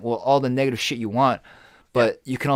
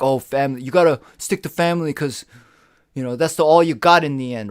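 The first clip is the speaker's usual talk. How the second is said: mocking quote; 3 semitones higher, 7 dB louder than usual. Mock family belief quote.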